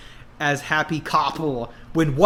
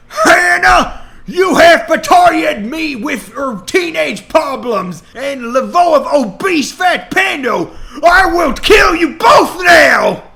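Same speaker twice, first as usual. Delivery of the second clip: high, loud raging villain scream; furious shout; 12 semitones higher, 9 dB louder than usual. Acted. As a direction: scream the betrayal in fury. Villain screams threat at couple.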